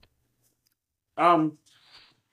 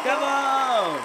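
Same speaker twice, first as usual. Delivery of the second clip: excited cheer; 9 semitones higher than usual. Real, not acted. Hyping up the guest.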